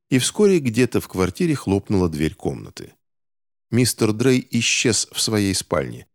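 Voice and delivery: plain reading voice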